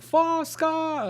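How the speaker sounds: high, slow mock Southern drawl; Hillary Clinton's Southern accent. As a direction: stretch it into a fake Southern accent. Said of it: "looking for that Hillary Clinton".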